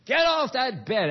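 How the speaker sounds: slow, loud, high order at the dog; stern shouted command; sharp command. Shown as a demonstration.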